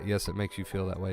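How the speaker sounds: ordinary delivery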